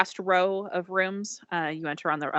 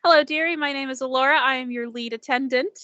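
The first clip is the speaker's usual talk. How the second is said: high, sugary hostess voice; sweet attendant; 7 semitones higher, faster than usual. Acted. Lead attendant welcoming the new passenger, high and sugary. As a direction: greet the guest like a doting aunt. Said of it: "greets you and says".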